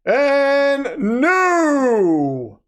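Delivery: ring-announcer call with a long falling NEW; title announcement. Pretend. Streamer announcing a new title holder, ring-announcer call. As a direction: stretch it out high like a ring announcer. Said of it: "you're gonna get the announcement".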